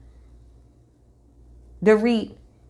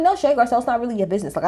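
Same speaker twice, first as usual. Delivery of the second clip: ordinary talk, nothing marked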